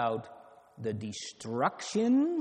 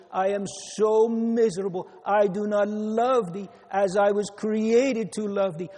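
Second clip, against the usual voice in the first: high, fervent confession, hammering each "so"; crying to God; 5 semitones higher, 5 dB louder than usual. Acted. Preacher acting a child confessing sin to the Lord, fervent cry. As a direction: plead as a penitent child in prayer, voice raised. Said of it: "That learns to cry unto the Lord".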